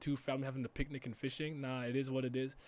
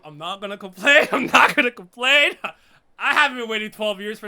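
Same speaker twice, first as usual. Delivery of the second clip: much louder, higher, laughing; loud laughing protest; 4 semitones higher, 15 dB louder than usual. Real, not acted. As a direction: shout it, cracking up. Mock denial while laughing.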